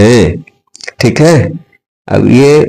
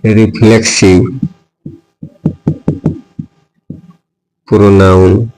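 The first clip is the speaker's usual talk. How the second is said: very slow, stretched words for copying; slow dictation; much slower than usual. Shown as a demonstration. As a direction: read it out word by word for notes. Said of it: "teacher dictating the definition". (धीमे-धीमे लिखवाना)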